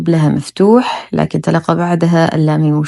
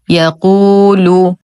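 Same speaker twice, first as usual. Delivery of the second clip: slow chanted word, vowels held; Quran recitation; 3 dB louder, much slower than usual. Shown as a demonstration. Teacher models the next word for learners, slow recitation. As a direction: recite it in measured tajweed.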